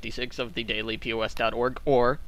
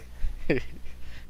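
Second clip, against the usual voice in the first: laugh; 4 dB quieter than usual. Real, not acted. Laughs at the accent.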